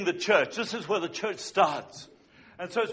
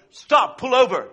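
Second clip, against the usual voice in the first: urgent call; 4 semitones higher, 8 dB louder than usual. Retold or imitated. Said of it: "she suddenly said to the husband".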